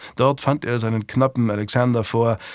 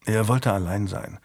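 usual speaking voice

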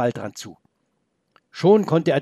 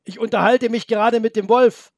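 higher, louder call across the field; calling back; 9 semitones higher, 4 dB louder than usual. Acted. Boy shouts to grandpa he is talking with the wolf.

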